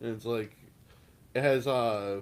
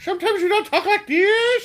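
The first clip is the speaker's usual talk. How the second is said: much higher, louder laughing squeal; squeaky silly voice; 9 dB louder than usual. Retold or imitated. Teasing a friend in the silly voice, squealing. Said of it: "The voice, right?".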